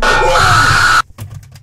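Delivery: loud high scream of fright; startled scream. Real, not acted. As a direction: scream at the jump scare. Jump scare.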